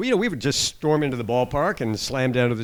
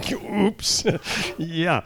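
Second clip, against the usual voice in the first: sheepish laughing; 5 semitones higher than usual. Real, not acted. Admitting he took wrong seats.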